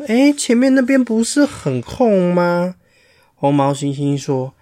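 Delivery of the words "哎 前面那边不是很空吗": higher, questioning chimp voice; puzzled chimpanzee. Acted. Chimpanzee to the line, asking why no one moves up, higher and puzzled. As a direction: point out the empty space up front, surprised. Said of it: "前面的黑猩猩说".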